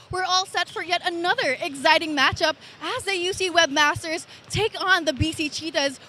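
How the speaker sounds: ordinary delivery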